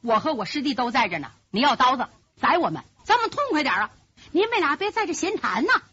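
ordinary delivery

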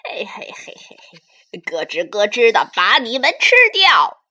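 loud growling monster threat; menacing dinosaur. Acted. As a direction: roar it like a hungry beast. Predator dinosaur threatens them.

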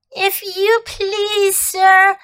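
put-on high, slow, trembling boy; barely able to speak for fear. A narrator's reading. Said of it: "trembling so that he could hardly speak".

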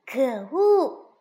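loud, indignant outburst; angry. A narrator's reading. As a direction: protest crossly at being teased. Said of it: "蹦蹦生气地说". (生气地说)

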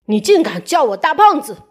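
a touch of indignant yelling; an insulted gentleman telling off Mr Small; mock angry shout. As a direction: play an offended gentleman, not too loud. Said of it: "他大喊".

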